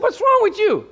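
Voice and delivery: high, loud rebuke; God's angry rebuke, pitched high. Retold or imitated. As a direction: scold him like an angry God. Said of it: "He was mad".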